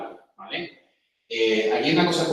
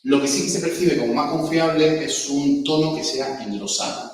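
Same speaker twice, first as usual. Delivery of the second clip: usual speaking voice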